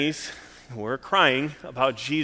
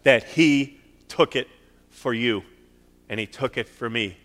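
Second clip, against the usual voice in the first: full-force preaching; 3 semitones higher, 8 dB louder than usual. Acted. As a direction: preach it out with full conviction to the church.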